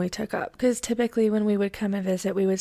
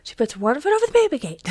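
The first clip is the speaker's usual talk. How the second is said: louder and faster, pushing the suspense; louder, faster retelling; 5 semitones higher, 6 dB louder, faster than usual. Shown as a demonstration. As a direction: punch it out quicker and louder. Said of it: "Stay with me".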